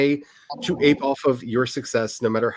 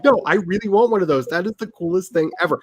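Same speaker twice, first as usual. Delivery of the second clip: eager mock plea; 7 semitones higher than usual. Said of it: "I was like".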